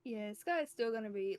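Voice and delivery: usual speaking voice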